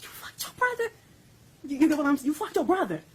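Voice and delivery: a mother's outraged cry, relived; retold furious yell. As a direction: shriek the accusation as she did then. Mother confronting son, retold.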